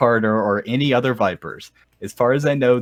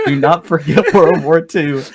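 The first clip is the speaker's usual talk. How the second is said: loud, laughing broken-English yelling; all caps shouting; 3 semitones higher, 9 dB louder than usual. Retold or imitated. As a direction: yell it like the all-caps text. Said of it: "That part's in all caps".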